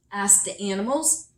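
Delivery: ordinary delivery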